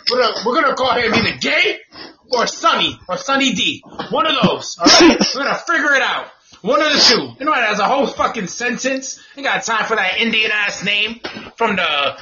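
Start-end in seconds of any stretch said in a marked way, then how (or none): none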